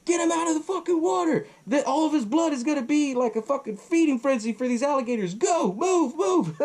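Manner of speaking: high, hammed-up yelling with laughter breaking through; Mary Beth taking charge with a gun; frantic shouted orders. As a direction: bark the commands high like she's panicking. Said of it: "she's like".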